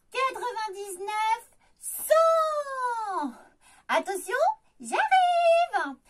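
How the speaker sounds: calling out high and loud, playful; hide-and-seek call rising high. Acted. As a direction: sing out a game warning.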